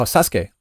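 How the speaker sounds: "Sasuke" as SAS-kay, silent u; Japanese pronunciation. Retold or imitated. Streamer correcting a schoolmate, Japanese-style "Sasuke". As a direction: say "Sasuke" in two beats, ending in kay. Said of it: "and I was saying".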